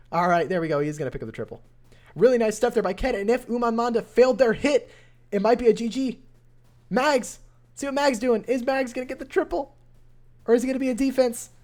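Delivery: lively caster calling the raid live; energetic esports caster narrating a close match; play-by-play commentary. Acted. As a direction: call the action as it unfolds.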